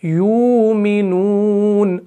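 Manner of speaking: very slow यूमिनून, drawn out; slow recitation. Shown as a demonstration. Teacher gives the underlying word slowly. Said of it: "असल में लफ्ज़ क्या है?".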